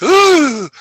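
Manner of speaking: squealed ooh, way up high; a shrill little ooh of surprise; high mock ooh. Mock gasp after the secret.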